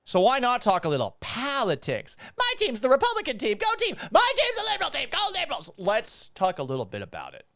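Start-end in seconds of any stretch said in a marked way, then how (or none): 2.4-4.0 squeaky cheerleader voice, way up high; high mock cheer. 4.1-5.7 squeaky cheerleader voice, way up high; high mock cheer. High mock cheer, other side.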